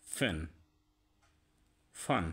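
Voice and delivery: ফ্যান and ফান with air pushed past lip and teeth; English f sound. Shown as a demonstration. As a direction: breathe out the f in ফ্যান. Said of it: "মুখ থেকে বাতাস বের হবে".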